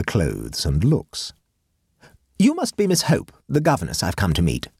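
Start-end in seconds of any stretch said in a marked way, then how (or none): 2.4-4.7 high, imperious grand lady; brooking no argument. Acted. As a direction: command it, allow no reply. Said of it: "in a tone that admitted of very little argument".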